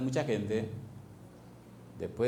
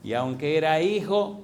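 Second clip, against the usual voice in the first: voz fuerte de predicador, marcando cada frase; predicación enérgica; 3 semitones higher, 3 dB louder than usual. Acted. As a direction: proclama la Escritura con fuerza a la congregación. Predica con fuerza. (el pastor predica con fuerza y énfasis)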